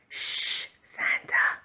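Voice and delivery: hushed unvoiced shush; whisper. A narrator's reading. Cat hushing Santa in the dark house, whispered. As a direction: shush him under your breath. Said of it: "she whispered".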